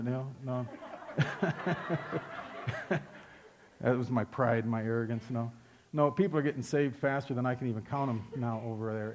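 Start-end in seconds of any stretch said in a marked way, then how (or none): 0.7-3.0 breathy laugh. Real, not acted. Laughs at his own pride.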